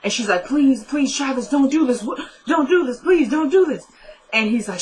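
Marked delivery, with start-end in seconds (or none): 0.5-3.8 higher, insistent mock begging; retold pleading. Kelly begging Travis while he chokes her, acted pleading. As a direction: act out begging him to stop, pitch climbing.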